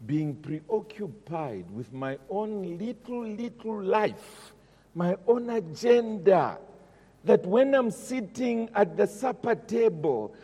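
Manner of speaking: preacher pleading at full force; impassioned preaching. Acted. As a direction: drive the confession home to the congregation. Impassioned sermon climb.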